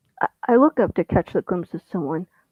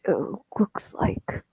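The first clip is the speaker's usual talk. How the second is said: mumbling; 6 dB quieter, slower than usual. Acted. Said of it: "I mumble".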